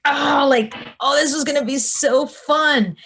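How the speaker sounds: high, loud whiny wail of disappointment; mock whine. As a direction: whine like the fun was stolen. Retold disappointment over cancelled tour.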